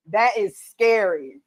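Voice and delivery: ordinary talk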